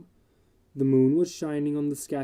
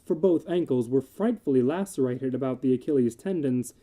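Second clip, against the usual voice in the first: ordinary delivery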